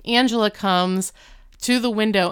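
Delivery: ordinary talk, nothing marked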